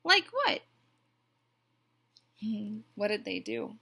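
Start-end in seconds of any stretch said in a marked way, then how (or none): 0.0-0.6 very high, loud duck question; high-pitched query. A narrator's reading. Duck presses Goose for the plan, high-pitched query. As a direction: ask it with voice shooting up.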